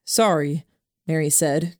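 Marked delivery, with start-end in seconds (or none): none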